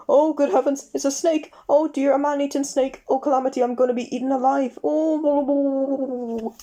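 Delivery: high, rushed panicky babble; hysterical fretting. A narrator's reading. Mr Jelly mistaking the worm for a snake, high panic. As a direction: fret hysterically then trail off.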